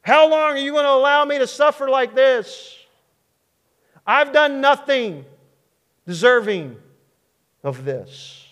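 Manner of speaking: loud, high outcry, dropping at the end; accusing cry. Acted. Suffering David accusing God of allowing it, anguished outcry. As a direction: hurl the question as an accusation. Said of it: "almost like an accusation".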